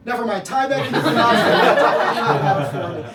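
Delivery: normal talking voice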